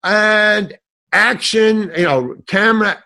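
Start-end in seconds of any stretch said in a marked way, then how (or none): 0.0-1.9 loud, long-drawn call to the set; director's yell. Retold or imitated. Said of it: "And he yells". 2.5-3.0 raised, loud call to the crew; director's yell. Retold or imitated. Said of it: "And he yells".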